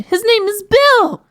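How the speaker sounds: high, slow, loud insistence; high-pitched exasperated yell; crying out. A narrator's reading. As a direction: cry it out, drawing out each word. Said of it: "the princess cried".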